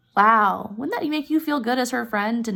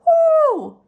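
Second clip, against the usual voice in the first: loud high whoo; 8 dB louder than usual. Real, not acted. Teacher imagining a thrown-away gift, a loud high whoo. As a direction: let out a sharp high whoo. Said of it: "I'd be pissed".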